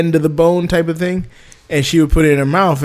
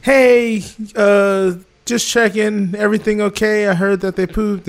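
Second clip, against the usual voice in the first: higher, light wife's voice over speaker; voice of God; 6 semitones higher, slower than usual. Retold or imitated. Wife checking in through the house speaker, higher and light. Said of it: "a voice of God appears and is like".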